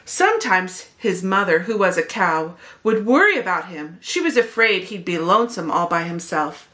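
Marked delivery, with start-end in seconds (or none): none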